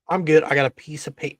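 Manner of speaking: normal talking voice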